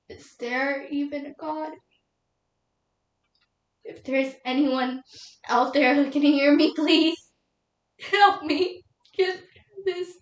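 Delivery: halting, tearful plea; grieving widow's desperate prayer. Acted. Widow begging God for help before the eulogy, tearful. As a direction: start hushed, then plead with breaking composure.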